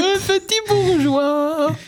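high, slow mocking drawl; mocking posh. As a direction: drag it out, high and snooty.

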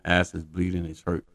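normal talking voice

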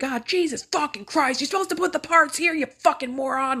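high-pitched hammed-up exasperation; acted tirade, voice jumping way up; mock factory rant. Factory worker cursing a co-worker, mock furious. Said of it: "I can be like that".

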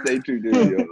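repeated high through a laugh. Pretend.

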